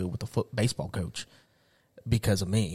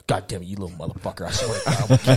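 dad cursing; 4 semitones lower, 5 dB louder, faster than usual. Retold or imitated. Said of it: "my dad be out there".

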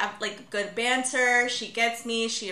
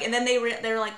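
normal talking voice

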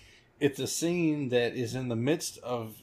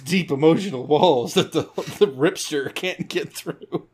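higher, laughing through a hammed-up plea; laughing mock sentiment; 4 semitones higher, 5 dB louder than usual. Host parodying the hero's sappy line, laughing mock plea. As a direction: giggle out the tearful question.

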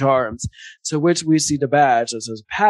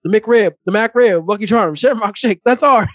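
mock Bernie Mac; 6 semitones higher, 5 dB louder than usual. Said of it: "He's like".

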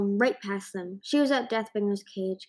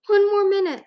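urgent; 12 semitones higher, 9 dB louder than usual. A narrator's reading. Urgent reply to panicked dragon.

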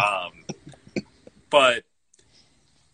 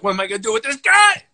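louder, higher and fast, a tossed-off mock rant; a burst of mock mobster exasperation; mobster's exasperated outburst; 10 semitones higher, 9 dB louder, faster than usual. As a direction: play the hothead boss throwing up his hands. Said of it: "He's like".

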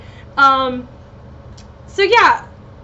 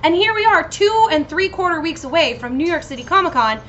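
ordinary delivery

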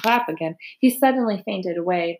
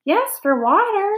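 high-pitched, meek little reply; small high voice, not raised; 11 semitones higher than usual. A narrator's reading. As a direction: answer meekly after a scolding. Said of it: "said Lurvy meekly".

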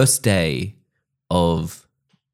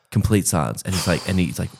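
ordinary delivery

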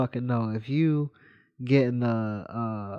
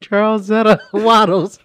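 laughing; 8 semitones higher, 8 dB louder than usual. Real, not acted. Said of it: "We can't even get through the story".

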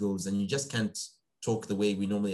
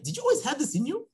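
much higher, amazed question; surprised son. Retold or imitated. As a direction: ask it in disbelief. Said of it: "I was surprised".

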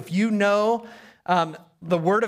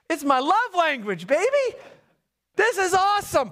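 high-pitched, gleeful outburst; excited gushing; 11 semitones higher than usual. Retold or imitated. Preacher answering fellow pastor about sarcasm, excited. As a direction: exclaim it like a thrilled fan, pitch up.

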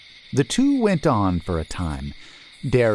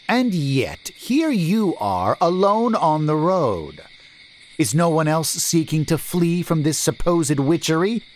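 challenging merchant; 8 semitones higher than usual. A narrator's reading. Merchant questioning why the stranger is alone, doubtful. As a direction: catch him in the lie.

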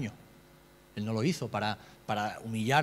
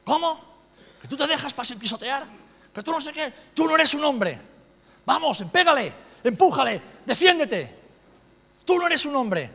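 much higher and louder, a scornful bellow; furious father; 12 semitones higher, 8 dB louder than usual. Retold or imitated. Macho father yelling at his son in the arcade, furious. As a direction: berate the boy like a proud macho dad shaming his son. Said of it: "como buen macho ibérico".

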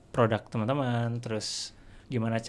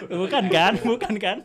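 laughing; 8 semitones higher than usual. Real, not acted. Laughing at own joke.

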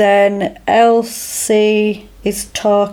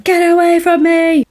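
high-pitched mock yell; imitated yell, pitched high rather than very loud; retold yell; 9 semitones higher, 3 dB louder than usual. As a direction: act out yelling someone away, voice jumping up. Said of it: "he's just yelling at her basically".

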